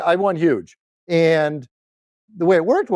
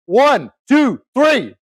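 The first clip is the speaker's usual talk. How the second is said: loud, high count-in for a crowd; punchy, raised count-off; rallying count-in; 7 semitones higher, 4 dB louder, much slower than usual. Real, not acted. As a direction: call out the count to fire up the room.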